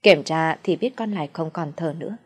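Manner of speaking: ordinary delivery, nothing marked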